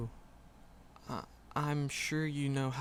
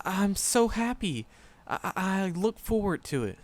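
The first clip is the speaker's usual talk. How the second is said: higher, louder bursting happiness; giddy and a little louder; overjoyed; 6 semitones higher, 5 dB louder than usual. Acted. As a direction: gush with stammering joy. Overjoyed after the date is accepted.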